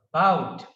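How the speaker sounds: "about" with a strong, falling BOUT; stress on second syllable. Shown as a demonstration. Teacher recaps word accent, "about" stressed on BOUT. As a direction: barely sound the a of "about", hit BOUT. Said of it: "like this".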